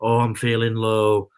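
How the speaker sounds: low-pitched, mock-downcast moan; mock gloom. Mimicking fans who say they feel low, mock gloom. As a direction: imitate a glum fan, dropping the pitch. Mocking everyone's gloomy quote.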